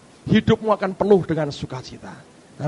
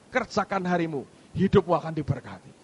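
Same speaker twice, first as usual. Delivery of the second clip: ordinary talk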